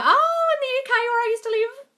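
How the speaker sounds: excited high-pitched aside; excited squeal. Real, not acted. Excited about a viewer's location.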